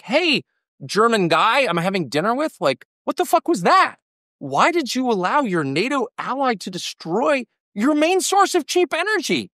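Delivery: mock-exasperated; incredulous mock rant. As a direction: pitch jumps way up in disbelief. Retelling his own dinner rant.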